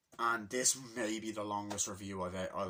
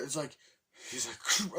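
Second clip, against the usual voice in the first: ordinary delivery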